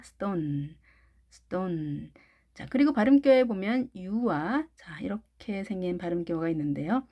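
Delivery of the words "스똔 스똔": 스톤 with T hardened to ㄸ, like 스똔; tense T. Shown as a demonstration. Teacher repeats the model for learners, tense-T 스똔. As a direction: say 스톤 as 스똔, softer and lower. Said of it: "이렇게 발음을 해주시면 좋겠습니다".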